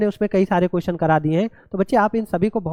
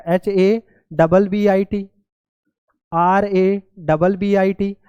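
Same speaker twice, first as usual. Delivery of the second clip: slow letter-by-letter spelling; much slower than usual. Shown as a demonstration. Teacher spells words letter by letter.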